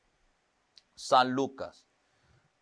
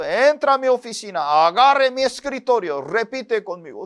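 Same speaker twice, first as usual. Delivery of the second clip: higher, louder order from the pastor; stern pastor; 6 semitones higher, 4 dB louder than usual. Retold or imitated. Preacher imitating his old pastor ordering more fasting, stern. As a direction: bark it like a demanding pastor.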